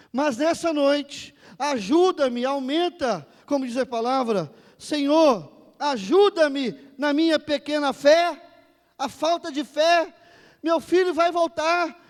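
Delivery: high, very loud declarations; thundering, fervent intercession; full-force preaching prayer. Real, not acted. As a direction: cry out to God in bold faith.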